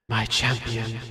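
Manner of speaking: echoing voice from nowhere; mysterious voice. Acted. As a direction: call to your champion as a disembodied, reverberant voice. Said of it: "mysterious voice that I know who".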